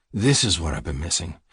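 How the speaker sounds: plain reading voice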